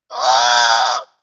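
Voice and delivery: loud, low, drawn-out mock cry; overwhelmed wail. Breeder overcome by mentor's praise, wailing. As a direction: bawl wordlessly as if losing it. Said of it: "I was losing my shit".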